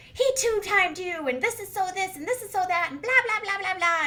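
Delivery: high, loud mock outrage; hammed-up sister on a rant; yammering rant. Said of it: "yammering on about what a tool bag Tom is".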